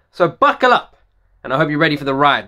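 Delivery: ordinary talk, nothing marked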